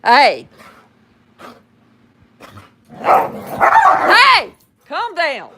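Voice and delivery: loud, high mom yell; mama voice. Real, not acted. Mom yelling at rough-playing kids to calm down. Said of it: "had to use my mama voice".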